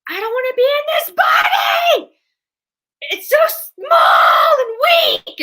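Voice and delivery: high, loud, crying panicked shout; panicked outburst. Retold or imitated. Reliving a regression, she cries out in loud panic. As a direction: scream it out as if trapped in a tiny body. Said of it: "And I was saying things like".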